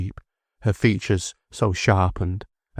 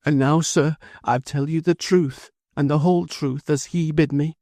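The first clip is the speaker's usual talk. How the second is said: high, pleading, choked-up mother; choking voice; 6 semitones higher than usual. A narrator's reading. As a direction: plead through tears to the judge. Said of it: "addressed him with her choking voice".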